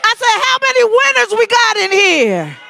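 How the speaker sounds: rally speech. Real, not acted. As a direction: pump up the crowd, shouting. Host hypes bingo.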